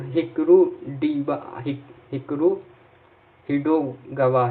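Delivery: हिकरू डीबा drawn out slowly, each syllable apart; slow teacher's dictation of हिकरू डीबा; slow spelled-out name. Shown as a demonstration. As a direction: stretch हिकरू डीबा so the class can copy it. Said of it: "याद करना".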